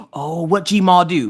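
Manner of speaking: put-on slow, nosy little-boy voice; imitating a nosy son asking for gossip. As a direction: lean in like a nosy kid.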